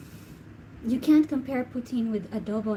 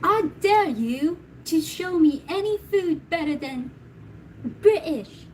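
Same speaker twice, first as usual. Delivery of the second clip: put-on British girl quote; 6 semitones higher, 4 dB louder than usual. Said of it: "That viral video".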